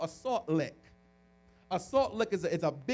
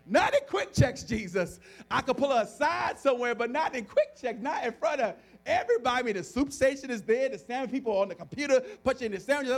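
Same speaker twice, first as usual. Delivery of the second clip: high, loud bargaining with Jesus; worked-up, exasperated objection; comic pleading protest; 7 semitones higher, 7 dB louder than usual. Retold or imitated. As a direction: beg off in mock panic.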